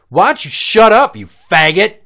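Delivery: very loud, high put-on outburst; hammed-up shouted insult; mock angry yell. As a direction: screech it like an irate neighbor. Mimicking homeowner's insult.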